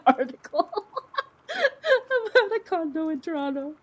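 words breaking into high laughter; laughing while telling a real news story. Real, not acted. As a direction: laugh through it, can't hold it in. Laughing at the blood-elevator article.

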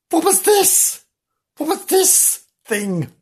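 very high put-on questioning; indignant newcomer. Bernard confronted with the new puppy, high indignant.

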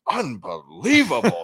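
high, slow, drawn-out amazement; mock amazement. Imitating his own stunned reaction to the CEO's revenue. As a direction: act stunned by the news. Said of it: "I was like".

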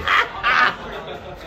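airy unvoiced laugh; breathy laugh. Real, not acted. Laughs after the mock message.

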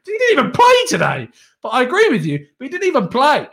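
can't believe the question; incredulous. Real, not acted.

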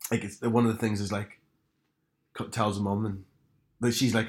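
normal talking voice

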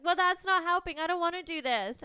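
hammed-up refusal to cooperate; re-enacting a stroppy argument; mock petulant protest. Retelling the quarrel with James.

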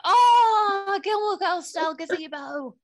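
high, loud thrilled squeal; light up with delight; excited. Real, not acted. Excited recognition of gazebo.

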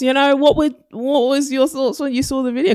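very high, loud prompting question; high-pitched host voice. Acted. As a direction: pitch it way up like a presenter.

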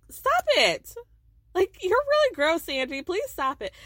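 imitated Monica protesting. Monica telling Angie to stop, high and loud. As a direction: squeal it out in disgust.

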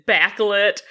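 ordinary talk

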